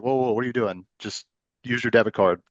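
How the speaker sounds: normal talking voice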